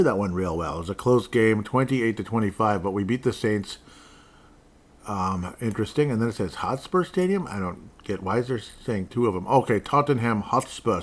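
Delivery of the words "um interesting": ordinary delivery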